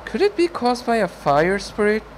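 high-pitched, wondering character voice; questioning story character. Acted. Voices Fedalin replying in story.

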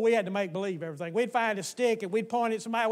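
ordinary talk, nothing marked

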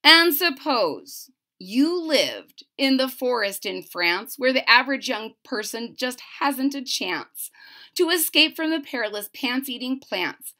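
plain reading voice

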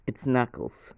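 ordinary talk

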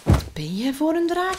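put-on high child's voice calling out; called-out challenge. A narrator's reading. Finn challenging the dragon, calling out high. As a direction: call the question up high, challenging. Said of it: "riep Finn".

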